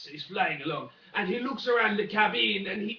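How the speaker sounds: normal talking voice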